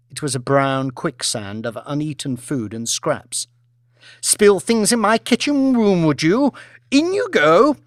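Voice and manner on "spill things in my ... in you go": high, loud, gloating scold; vicious command. Acted. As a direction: relish the punishment. Lady punishing waitress.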